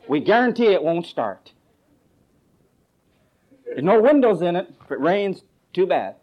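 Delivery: high, loud used-car pitchman; car commercial pitch. Acted. Preacher spoofs a car dealer's commercial for a congregation, hammed-up pitch. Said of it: "stupid enough to make a commercial".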